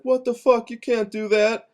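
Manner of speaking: mock high-pitched protest; outraged friends. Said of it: "They're like".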